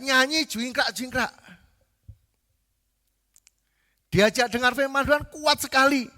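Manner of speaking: high, loud preaching; khotbah berapi-api. Real, not acted. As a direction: drive each point home to the congregation at full force. Preaches at full force. (berkhotbah lantang dengan nada tinggi)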